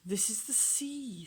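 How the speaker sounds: usual speaking voice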